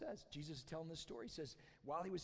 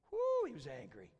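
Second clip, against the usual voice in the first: high, loud whoop of emphasis; emphatic exclamation; 5 semitones higher, 7 dB louder than usual. A narrator's reading. Preacher exclaims at the brother's anger, emphatic whoop. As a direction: open on a loud high "Woo" to stress the anger. Repeat, exclaiming how angry.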